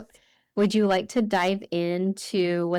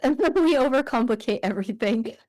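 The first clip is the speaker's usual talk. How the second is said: laughing through the words, pitched up; amused laugh at human nature; chuckling while admitting it; 5 semitones higher than usual. Real, not acted. Laughing at shared overthinking.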